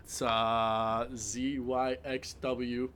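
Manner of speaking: put-on slow slurred drunk reciting; blackout drunk. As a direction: fumble the letters like a drunk. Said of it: "Oh, he's drunk".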